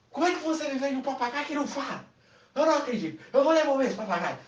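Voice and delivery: high, fast indignant complaint. Acted. Woman complains by phone to the pet shop man. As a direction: demand an answer, outraged.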